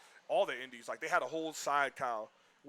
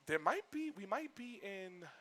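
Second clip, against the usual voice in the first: high-pitched, halting female rep impression; imitated tentative rep; 7 semitones higher, slower than usual. Ubisoft rep hinting to two brothers, imitated tentative rep.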